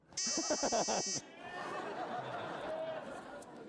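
a sudden high burst of laughter. Real, not acted. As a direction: laugh out at your own slip. Laughing at the reading mix-up.

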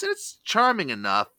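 usual speaking voice

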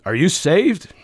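abrupt, pushy "are you saved" challenge; blunt evangelist question. Pretend. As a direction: fire it at them point-blank. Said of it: "and you don't say".

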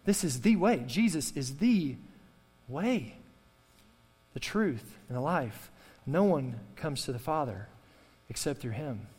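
slow, measured scripture reading; solemn closing line of the sermon; solemn preaching. Real, not acted. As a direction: lay the verse down gravely. Preaching climax.

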